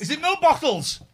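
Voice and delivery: loud, high and eager; hope hard for your favourite sweet; excited. Real, not acted. Hoping the sweets are milk bottles.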